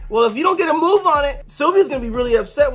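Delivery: pushy scolding. Retold or imitated. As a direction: hurry her up, impatient. Goose scolds tired cat.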